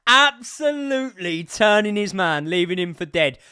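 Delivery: high, loud, slow raving; ecstatic shout. Real, not acted. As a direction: shout the praise with delight. Raving about the goal.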